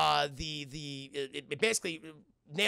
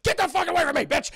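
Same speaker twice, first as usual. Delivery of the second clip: high, loud furious yell; acted fury at full volume; enraged; 12 semitones higher, 8 dB louder than usual. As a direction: lash out in a panicked shout. Patient fights off sedative.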